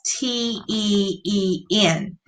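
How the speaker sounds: T E E N with a stretched final N; slow letter-by-letter spelling; spelled out slowly. Shown as a demonstration. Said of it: "it has T E E N".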